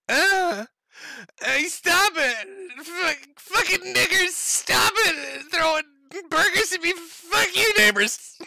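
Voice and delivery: very high, loud, crying squeal of a furious little man; hammed-up high-pitched wail at the burger throwers; shrieking mock Nick. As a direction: shriek it like a toddler having a tantrum.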